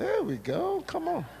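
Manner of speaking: delighted high-pitched there we go; excited high call. Real, not acted. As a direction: pitch jumps high, playful cheer. Cheering spotted Nigerian.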